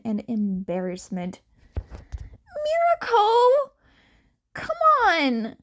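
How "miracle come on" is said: high, loud whiny complaint; whining. A narrator's reading. Izuku whining at Mirko after her kick. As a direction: whine it out like a sore kid. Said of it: "Izuku whined as he got up".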